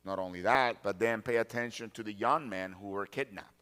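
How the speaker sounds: normal talking voice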